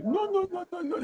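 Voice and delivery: mock French accent. Copying Trump mimicking Macron, in a high mock French accent. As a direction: squeal non non non like Trump playing Macron. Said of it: "Trump veut imiter l'accent français".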